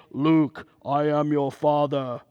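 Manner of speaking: low, distant Vader impression; lightly voiced movie villain; Darth Vader line. Pastor mimics Darth Vader quoting Luke, low and distant.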